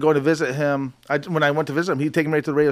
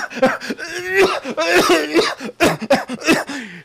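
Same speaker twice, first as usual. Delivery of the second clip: imitated coughing fit; 11 semitones higher than usual. Dad coughing fit retold.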